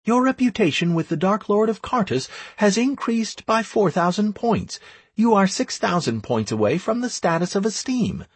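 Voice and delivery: brisk high-pitched proclamation; game system announcement. Acted. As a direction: rattle off the notification on a high, level pitch.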